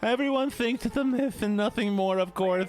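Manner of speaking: high, know-it-all nerd voice; a smug nerdy professor. Acted. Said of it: "classic nerd".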